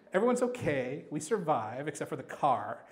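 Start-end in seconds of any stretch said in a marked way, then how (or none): none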